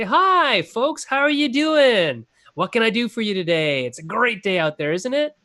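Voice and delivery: high, swooping American greeting; best American accent. Acted. Restaurant server greets customers, putting on an American accent. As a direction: lay on a folksy American accent. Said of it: "with my best, uh, American accent".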